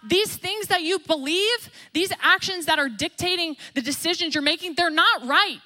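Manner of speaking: a hint of a shouted warning; mock shout to the world. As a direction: call it out like a street preacher. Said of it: "going out and shouting".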